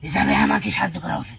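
loud demand. Real, not acted. Spirit demands help from one of its kind, loud appeal. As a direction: call out loudly for help owed.

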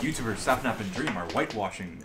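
mock news announcer. Streamer joking about the queso debate, mock news announcer. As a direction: announce it like a joke news bulletin.

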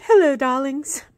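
a light British "Hello, darlings"; playful British greeting to the viewers; British accent. Acted. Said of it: "I just started talking in a British accent".

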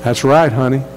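a hint of a Texas pastor's drawl. Pastor answering a woman after service, mild Texas drawl. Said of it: "in his Texas drawl".

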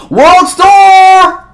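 "World Star!" yelled very high and loud; hype shout. Retold or imitated. Spectator hyping the fight, "World Star" shouted very high.

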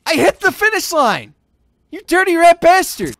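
high, loud indignant yell; outraged protest. Real, not acted. Gamer protesting a denied finish, outraged protest. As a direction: protest at being cheated, voice way up.

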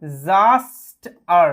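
ডিজাস্টার drawn out very slowly and loud; slow, drawn-out pronunciation. Shown as a demonstration. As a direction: stretch every sound of ডিজাস্টার. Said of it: "আমি বোঝাই". (ডিজাস্টার খুব ধীরে টেনে টেনে উচ্চারণ)

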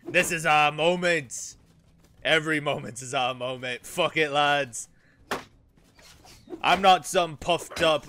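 loud, roaring raider captain; raider war speech. Acted. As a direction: bellow at your Ironborn crew. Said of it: "ability to roleplay".

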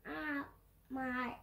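ordinary talk

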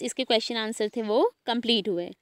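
ordinary talk, nothing marked

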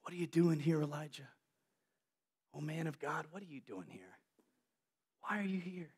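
hushed, soft voice of God; whispering. Acted. God whispering to Elijah in the cave, hushed. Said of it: "he whispers".

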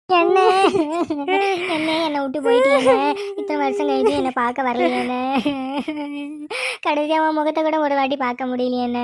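wailing lament broken by long sobs; keen theatrically, dragging out the ends; put-on crying. Aunt laments being left behind, theatrical weeping. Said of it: "ஏண்டி நீ எதுக்குடி அழுவுற?".

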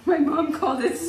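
amused, voice lifting toward a burst of laughter; about to crack up. Real, not acted. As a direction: push the words out before laughter hits. Breaking into laughter.

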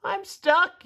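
high, loud yell of a stuck turtle. A narrator's reading. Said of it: "yelled Tudley".

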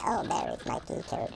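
soft unvoiced whisper. Real, not acted. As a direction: breathe it out, keeping hidden. Spotting key card quietly.

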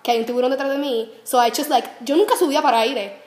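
ordinary talk

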